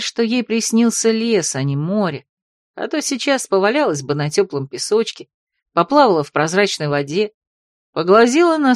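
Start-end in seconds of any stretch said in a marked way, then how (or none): none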